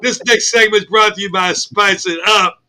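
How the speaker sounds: normal talking voice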